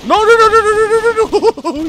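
high, loud string of panicked noes. Real, not acted. Gamer screaming no as his character is about to die. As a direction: scream no over and over as he goes down.

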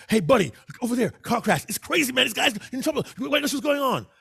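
fast, high and loud Jamaican-free alarm; panicked friend spotting a car crash; sudden excited character. Acted. Excited friend points out a car crash to his buddy, fast and high. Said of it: "The other's all sudden".

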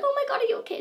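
very high, fussing mock concern; shrill-pitched mock worry; mock concerned crowd. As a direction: parody the fussing onlookers up high. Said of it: "everyone's like".

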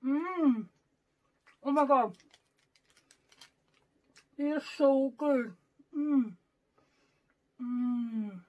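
muffled chewy speech; talking while chewing. Real, not acted. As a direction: mouth partly full. Seen 0: Puts candy in mouth at -9, keeps chewing while talking.

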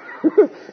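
short, high burst of laughter. Real, not acted. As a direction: crack up mid-story. Laughing at girlfriend's reaction.

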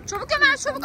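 high, rushed urging; urgent hurrying. Real, not acted. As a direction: hurry Ömer along. Rushing kid to eat. (acele ettiren heyecanlı ses)

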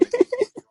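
shrill mock giggle; high giggle. Giggle after the imitation.

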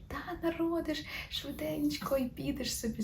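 вищим, тихішим голосом лікарки; легко підбадьор, ніби це дрібниця; безтурботно, на лайті. Retold or imitated. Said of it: "На лайті каже". (імітуй позитивну лікарку на лайті)

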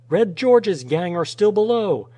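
high, loud alarm call; shouted warning. A narrator's reading. Answering men on the hill, urgent warning shout.

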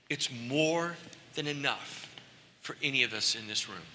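ordinary talk

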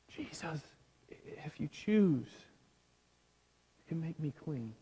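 very soft, hushed plea; muttered under breath. Acted. Leprous man begging Jesus face to the ground, muttered. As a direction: beg softly, barely voiced, with halting pauses. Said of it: "mutters under his breath".

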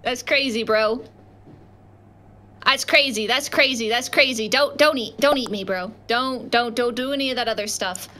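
ordinary delivery

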